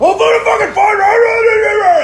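high, loud, slow retold shout; cop shouting. Cop screams at kid pinned on the trunk, shouting. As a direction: yell it like an angry cop. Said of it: "And he's like".

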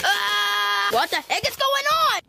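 high, fast alarmed cry; startled shout. Pretend. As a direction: yelp and blurt it out fast in shock. Toy suddenly sees chaos.

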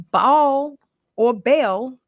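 "Baal or Bel" drawn out slowly, rising on "Baal"; slow spelled-out pronunciation. Shown as a demonstration. Explaining the name's meaning to viewers, slow careful sounding out. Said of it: "as we would say it over here".

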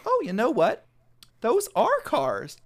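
high, loud, drawn-out realisation; reply with exaggerated pleased surprise; high-pitched hammed-up agreement; mock delighted teacher.

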